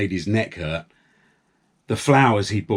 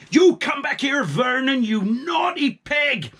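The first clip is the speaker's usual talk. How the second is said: angry shout; 13 semitones higher than usual. A narrator's reading. Farmer Palmer shouting after naughty Vernon, high and angry. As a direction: yell the runaway back.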